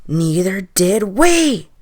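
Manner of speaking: very loud, slow, drawn-out boom. A narrator's reading. Chorus of the dead answers the old man, booming. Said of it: "the voices boomed".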